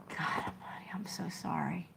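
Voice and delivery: stunned hushed whisper. Real, not acted. Consoling Angela over her friend's death, whispered. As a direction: hush it out in shock and sympathy.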